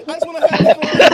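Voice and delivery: loud, laughing mock of a whiny line; laughing imitation of a whiny woman; mocking Shauna. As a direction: parrot her whiny catchphrase. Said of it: "you sound like Shauna right now".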